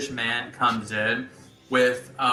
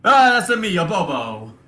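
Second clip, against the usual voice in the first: put-on Italian accent; 7 semitones higher, 6 dB louder than usual. Bobo repeating his name to the player, hammed-up Italian accent. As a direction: proudly announce yourself, Italian-style. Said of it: "The stereotypes are strong".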